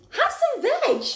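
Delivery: very high, loud hooted voice; hooting. A narrator's reading. Duckbills offering Drip food, very high loud hoot. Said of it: "And they hooted".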